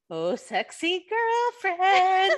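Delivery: high, loud, drawn-out mock quote; film catchphrase. Blurting the line stuck in their head, drawn-out catchphrase.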